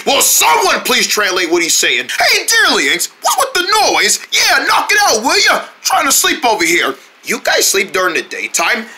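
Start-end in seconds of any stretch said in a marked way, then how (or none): 2.2-6.9 much higher, whiny protest at the noise; woken-up complaint. Acted. Sleeper telling noisy neighbors off, high annoyed complaint. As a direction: pipe up high, complaining about the racket.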